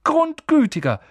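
ordinary delivery

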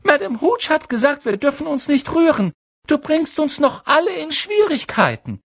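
put-on very high, urgent girl's call; Hermine Granger. A narrator's reading. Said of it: "rief Hermine Granger". (hohe, laute Mädchenstimme, mahnend)

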